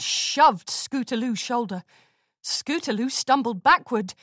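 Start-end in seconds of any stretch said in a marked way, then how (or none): none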